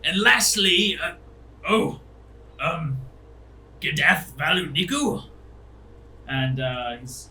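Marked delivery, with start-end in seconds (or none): 0.0-5.2 higher roll call faltering into hesitation; mildly startled. Acted. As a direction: call the name, then falter, taken aback. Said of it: "He looks, like, scared even".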